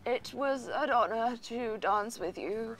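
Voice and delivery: slow, soft British gentleman; Ducky. Shown as a demonstration. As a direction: play a courtly old Brit, savoring each word. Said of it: "her impression of Ducky".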